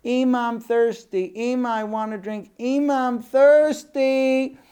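put-on high, loud, stretched-out kid whine; child near crying. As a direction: nag in a child's voice, building to a drawn-out wail. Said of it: "And he starts crying too".

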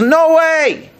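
high-pitched, emphatic retort; emphatic refusal. Retold or imitated. Teacher quoting a rabbi's ruling to students, emphatic refusal. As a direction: voice the rabbi's flat-out objection, pitched well up. Rabbi's firm objection acted out.

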